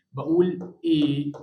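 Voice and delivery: slow level إيه as if objecting. Shown as a demonstration. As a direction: draw out إيه flat like a protest. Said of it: "أكني بعترض على حد". (إيه ممدودة كأنك بتعترض)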